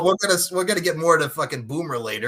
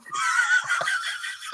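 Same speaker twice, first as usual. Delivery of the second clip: shrieking laugh. Real, not acted. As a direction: crack up helplessly. Bursts out laughing at co-host's answer.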